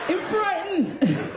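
high, drawn-out comic exclamation; mock frightened Jamaican. Comic quoting a Jamaican saying, drawn-out mock fright. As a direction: draw it out slowly and high. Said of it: "And that Jamaican saying".

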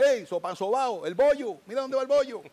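high, loud teasing street shout; playful shouted hail; calling out. Retold or imitated. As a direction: holler the nickname across the street. People call out nickname.

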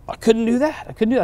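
high, astonished; high amazed exclaim; amazed disbelief. Retold or imitated. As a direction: she can't believe what her arms can do. Woman amazed she can move.

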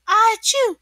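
high, loud achoo; sudden storybook sneeze; mock sneeze bursting out high; acted sneeze. A narrator's reading. Sick SpongeBob sneezes.

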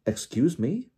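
taken-aback rising question; Diggory incredulous. A narrator's reading. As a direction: pitch jumping up in disbelief. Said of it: "said Mister Diggory incredulously".

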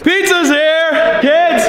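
calling out. Real, not acted. Calling kids to pizza.

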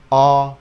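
"Awful" opening on a long, loud O. Shown as a demonstration. Teacher models the long O for learners, drawn-out vowel. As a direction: stretch the first vowel of "Awful". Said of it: "O hai chấm đây là O dài".

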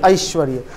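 low, falling ऐश्वर्य with an "a-i" glide; correct pronunciation. Shown as a demonstration. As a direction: say ऐश्वर्य as "a-ish-va-rya". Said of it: "सही उच्चारण अभी कर लें".

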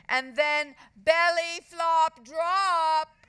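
high, loud, stretched-out boast; gloating girl's holler; loudly. A narrator's reading. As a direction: draw out each word to show off. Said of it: "said Margaret loudly".

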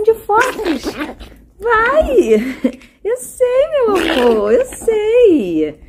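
high, excited pet talk. Real, not acted. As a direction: squeal it to the dog. Owner talks to her pet dog.

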